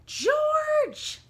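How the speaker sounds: very high, very loud screech. A narrator's reading. Mother screeching at her giant son, high. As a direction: shriek his name. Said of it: "a high voice screeched".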